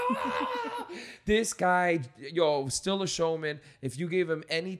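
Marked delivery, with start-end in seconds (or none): 0.1-0.9 very high excited yelp of the crowd; hyped cheer. Retold or imitated. Comics backstage egging on the Iron Sheik, high excited yelp. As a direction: squeal like starstruck comics. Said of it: "we're all in the backstage going".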